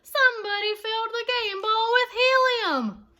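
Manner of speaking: high, loud outraged call; fan shouting. A narrator's reading. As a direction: yell it to the whole stadium. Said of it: "shouted a fan in the bleachers".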